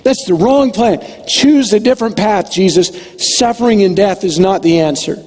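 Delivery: a disciple lecturing his teacher, exasperated; Peter scolding Jesus. Retold or imitated. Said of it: "Peter said something to the effect of".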